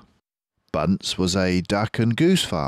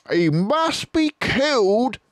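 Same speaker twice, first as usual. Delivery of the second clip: much higher, slow declaration; Bean crying out; 4 dB louder than usual. Acted. Bean demanding the fox's death, high cry. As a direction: pronounce the sentence coldly. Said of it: "cried Bean".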